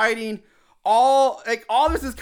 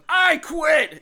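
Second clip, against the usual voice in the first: high-pitched acted yell; mock yell; 8 semitones higher than usual. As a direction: yell it like a fed-up employee quitting. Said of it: "Yells at Andy".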